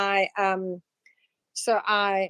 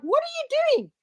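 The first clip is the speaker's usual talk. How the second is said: much higher squeaky mock mind voice; the shocked mind yelping at you; startled inner voice. Voicing your own startled mind.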